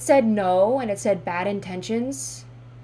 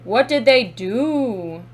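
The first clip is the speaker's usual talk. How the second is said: loud, slow, drawn-out question; loud demand; 7 dB louder, slower than usual. Real, not acted. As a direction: demand an answer, stretching each word. Demands to know what happened.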